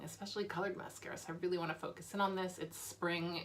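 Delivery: ordinary delivery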